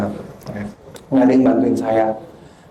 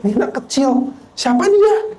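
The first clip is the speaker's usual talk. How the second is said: scornful onlookers; 12 semitones higher than usual. Retold or imitated. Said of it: "orang lain lihatnya".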